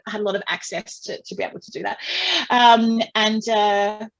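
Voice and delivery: usual speaking voice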